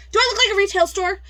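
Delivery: high, rapid-fire mock indignation; snarky seller. Seller answering a buyer's size request, snarky. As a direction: rattle it off, hammed-up and affronted.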